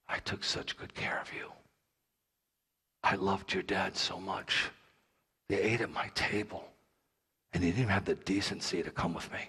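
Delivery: hushed, low and wounded; hurt, betrayed. Acted. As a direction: nearly whisper it as a betrayed king. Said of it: "He felt betrayed".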